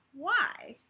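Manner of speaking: small, high curious why; simple child's question. Acted. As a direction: ask it plainly like a little girl. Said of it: "she simply asked".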